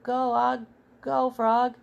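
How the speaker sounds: excited storybook holler; yelled cheering. A narrator's reading. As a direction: urge your mount on like a cowboy. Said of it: "I yelled".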